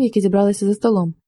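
usual speaking voice

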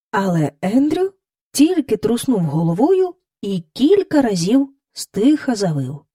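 ordinary talk, nothing marked

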